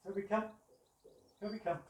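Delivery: high, loud recall call to the dog. Real, not acted. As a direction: call him in brightly, loud and up. Trainer recalling his dog.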